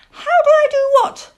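put-on very high, loud, quick owl-child voice; young owl, thoroughly puzzled; puzzled owlet. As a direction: fire back a baffled piping question. Plop puzzled by the greeting.

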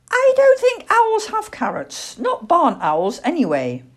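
high, loud little-owl objection; young owl Plop, doubtful. Acted. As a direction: owlet politely protesting, voice dropping at the end. Owlet doubting the carrot advice.

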